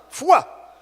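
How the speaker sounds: high, loud burst of "fua"; explosive spoken sound effect; sudden whoosh noise. Retold or imitated. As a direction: imitate something vanishing in a flash. Imitates the sudden rapture.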